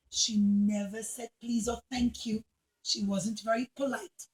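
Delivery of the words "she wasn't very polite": ordinary delivery, nothing marked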